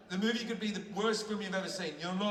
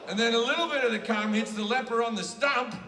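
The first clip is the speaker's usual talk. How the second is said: building loud punchline; 3 semitones higher, 6 dB louder than usual. Real, not acted.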